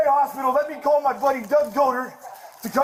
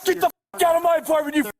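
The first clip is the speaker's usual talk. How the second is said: angry shouting; 5 semitones higher than usual. Real, not acted. Suspect screams at cops to leave his apartment, furious.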